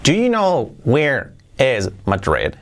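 slow, careful reading of the example. Shown as a demonstration. Teacher reading the second example slowly to students. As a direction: read it word by word. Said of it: "que sería esta".

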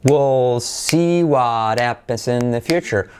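said at half speed, every word spelled out; slow model sentence. Shown as a demonstration. Teacher reading the model sentence slowly for learners. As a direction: lay out each word slowly for copying. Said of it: "Aquí está nuestra palabra".

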